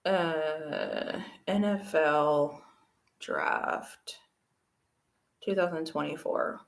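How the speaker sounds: slow, low, soft self-talk while searching; absent-minded typing murmur. Real, not acted. Streamer googling the draft mid-show, slow absent-minded murmur. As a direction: say it to yourself as you type.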